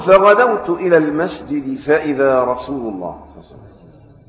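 slow formal classical Arabic reading; hadith recitation. A narrator's reading. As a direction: read the text slowly and solemnly. (قراءة الحديث ببطء ووقار)

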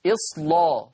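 إصلا with a thick, dark L; heavy lam. Shown as a demonstration. Teacher repeats the rule for students, heavy lam in إصلا. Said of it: "لا مغلظة".

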